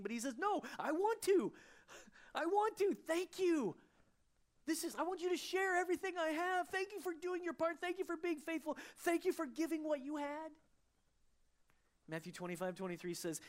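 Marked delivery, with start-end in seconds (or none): 0.4-10.5 very high, loud, eager insistence; Jesus thanking his faithful servant, high and loud; Jesus insisting. Acted. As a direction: plead with joy as Jesus.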